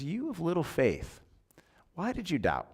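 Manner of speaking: Jesus asking Peter in the boat, softly; soft, quiet question. Retold or imitated.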